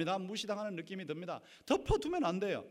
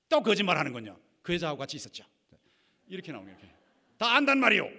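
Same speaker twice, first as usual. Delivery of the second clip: acted accusation; 8 dB louder, faster than usual. Wife accusing husband.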